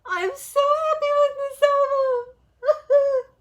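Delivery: high, slow, loud tearful voice; real tears of joy; crying happy tears. Real, not acted. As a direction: speak through joyful sobs. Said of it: "I am such a crybaby".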